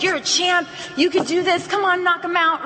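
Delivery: lightly voiced pep-talking coach; boxing coach. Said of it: "his coach is right behind him".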